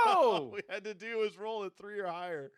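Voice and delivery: laughing in disbelief. Real, not acted. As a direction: laugh through the words. Failed roll, laughing in disbelief.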